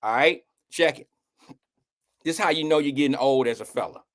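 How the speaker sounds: ordinary talk, nothing marked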